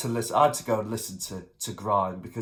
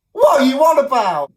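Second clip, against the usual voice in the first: much higher and louder, outraged yelling; furious outburst; 13 semitones higher, 12 dB louder than usual. Real, not acted. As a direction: explode at the song in disbelief. Ranting at awful music.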